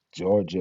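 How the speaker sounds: hard G in Georgia; Latin hard G. Shown as a demonstration. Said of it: "Never as in Georgia".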